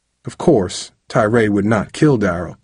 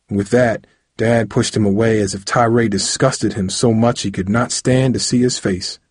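plain reading voice

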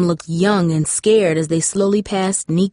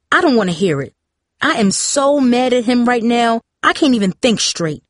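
tense and angry, pitch pushed higher; warning; 4 semitones higher than usual. A narrator's reading. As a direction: warn her daughter off, barely holding it together. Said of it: "her mother warned".

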